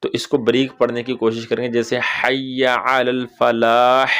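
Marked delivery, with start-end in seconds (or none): 1.7-4.1 slow, drawn-out call to prayer; adhan recitation demo. Shown as a demonstration. Teacher demonstrating the adhan phrase slowly. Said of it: "ऐसे करके".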